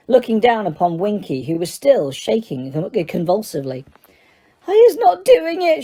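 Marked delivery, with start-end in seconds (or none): 4.7-5.8 very high, tearful squeak; squealing elf. A narrator's reading. Elf in tears protests to Diggory, squealing. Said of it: "she squealed".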